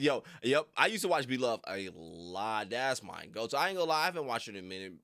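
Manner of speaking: usual speaking voice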